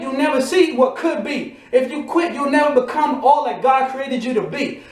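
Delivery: ordinary talk, nothing marked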